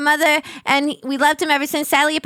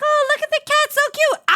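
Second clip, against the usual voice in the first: very high-pitched cooing over a cat; high mock fangirl gush; gushing squeal; 14 semitones higher, faster than usual. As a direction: squeal with delight, rising into a playful scream.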